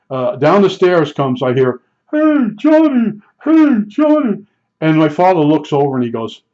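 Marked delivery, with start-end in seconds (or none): 2.1-4.4 high, slow, drawn-out call of the name. Retold or imitated. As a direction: sing the name out from the top of the stairs, high and stretched. Old boss calls from the stairs.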